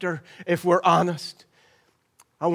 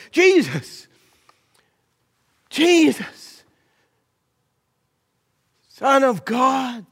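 loud, higher desperate cry, stretched slow at the end; shouting blind man; 7 semitones higher, 5 dB louder, slower than usual. Acted. Blind Bartimaeus crying to passing Jesus, loud pleading shout. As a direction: call out over a crowd for help. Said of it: "him shouting his head off".

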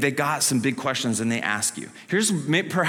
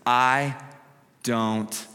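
slow, broken up with long pauses, fading; drawn out, each word dropped alone; halting admission; 4 semitones lower, slower than usual. Retold or imitated. Healed man admitting he doesn't know, slow and halting. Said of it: "He says".

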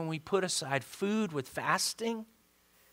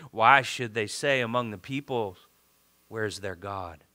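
solemn scripture reading; 6 semitones lower than usual. Acted. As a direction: read it as a hushed lament.

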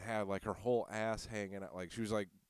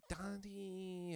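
mock flirty voice; 9 semitones higher, 10 dB quieter than usual. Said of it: "She was calling me daddy and all this goofy shit".

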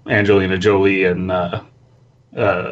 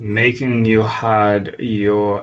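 slow creepy read; much slower than usual. Acted. Friend takes over creepy reading.

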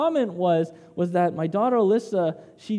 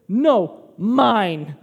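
put-on loud, blurted toddler refusal; punchy mock-toddler protest; possessive toddler; 6 dB louder than usual. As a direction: imitate a small child claiming it loudly. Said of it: "instinctively, she goes and she takes the bag".

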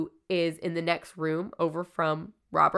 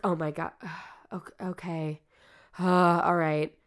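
reluctant, sighing mimicry; halting, uncomfortable agreement, lightly acted; imitated discomfort. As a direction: hesitate and sigh as the uneasy curator. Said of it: "the lady gets so uncomfortable".

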